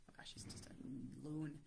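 soft fond whisper. Real, not acted. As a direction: whisper it as a loving aside. Fond aside about son.

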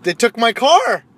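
loud, slow mock outrage; livid. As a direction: cry out in high indignation. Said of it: "I'm livid".

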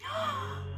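a sudden high gasp of surprise; sharp gasp. Pretend. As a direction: gasp in amazement. Spots a brontosaurus.

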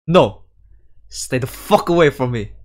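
loud, frightened yell pushing her off; terrified shout telling Anna to stay back; furious shout. Acted. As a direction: shout her away in panic. Panicked refusal of her touch.

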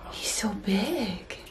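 slow hushed amazed whisper; awed whisper. Real, not acted. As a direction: gasp it in wonder. Gasped amazement at dog.

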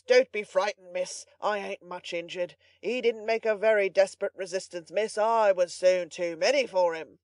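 boastful butler. A narrator's reading. Butler telling miss he beat the robber, self-important bragging. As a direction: reassure her with puffed-up importance.

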